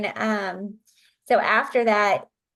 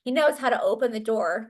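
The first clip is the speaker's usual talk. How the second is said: usual speaking voice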